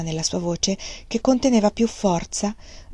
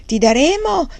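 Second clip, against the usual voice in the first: oily offer, pitched higher; unctuous; 8 semitones higher, 5 dB louder than usual. Acted. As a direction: sweet-talk the offer, trailing off. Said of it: "disse con voce untuosa". (voce untuosa, offerta melliflua)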